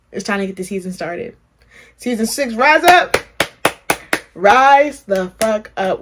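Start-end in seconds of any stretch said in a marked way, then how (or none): 2.0-3.1 high, loud rallying cry; shouted high and loud as a rallying cry; hype call. Pretend. Hyping the new season. 4.3-6.0 loud, slow, each word dragged out; slow, loud and heavy, demanding it rise. Pretend. Hyping the new season.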